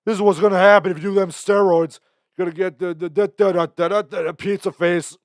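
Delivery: high, loud mock screaming; stammering, hollering sketch-coach impression; Coach Hines. Coach screaming at the children about steroids, high mock yelling. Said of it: "like, screaming at, like, the children".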